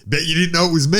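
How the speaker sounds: hammed-up gotcha; mock reveal voice. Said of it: "takes it off like".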